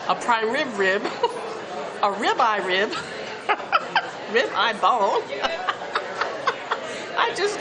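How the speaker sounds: giggling through the words; laughing. Real, not acted. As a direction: laugh between each item. Giggling through list of ribs.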